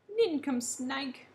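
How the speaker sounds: loud, slow angry shout. A narrator's reading. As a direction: bark it out, furious.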